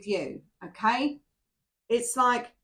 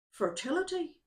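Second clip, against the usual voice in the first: Irish accent; 6 semitones higher, 3 dB quieter than usual. Shown as a demonstration. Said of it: "I do believe I just spoke a little bit of Irish then".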